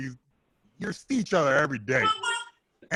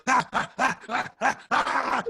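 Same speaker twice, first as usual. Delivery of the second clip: high strained yelping grunts; straining dog yelps; 10 semitones higher than usual. Retold or imitated. Fighter imitates a trapped dog, high yelps. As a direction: yelp like a dog caught on a fence. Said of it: "like a dog".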